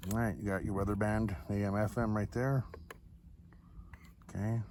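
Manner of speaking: normal talking voice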